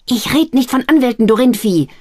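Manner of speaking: high, loud angry retort; passionate anger. A narrator's reading. Sister scolding her brother, passionately angry. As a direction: scold him hard. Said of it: "leidenschaftliche Stimme einer jungen Frau".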